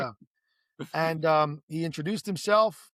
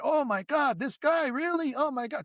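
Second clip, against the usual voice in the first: excited gasp; 8 semitones higher than usual. Retold or imitated. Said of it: "everyone was excited".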